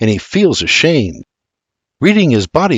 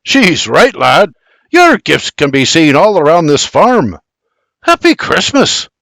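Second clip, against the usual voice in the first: louder, higher cheering Scots da; jovial father, louder; hearty father; 5 semitones higher, 4 dB louder than usual. Acted. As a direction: hail him with festive cheer. Bibi insisting cheerily.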